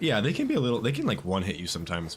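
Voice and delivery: usual speaking voice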